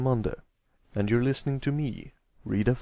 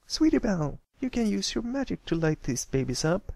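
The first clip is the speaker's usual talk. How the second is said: high, bright filly with a sudden idea; Scootaloo, high eager voice; 10 semitones higher than usual. Acted. Scootaloo urging Sweetie Belle to use magic, high eager filly voice. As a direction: call out your friend, excited by the plan.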